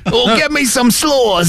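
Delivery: slow, hammed-up British accent; British drinker. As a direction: draw it out like a tipsy Brit. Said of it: "old guy with a British accent".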